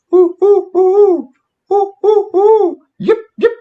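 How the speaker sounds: high loud owl hoots then yips. Shown as a demonstration. Re-enacting the owl calls heard at camp, high and loud. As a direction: hoot high and loud, then yip like a coyote. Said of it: "I'm just gonna do the sequence".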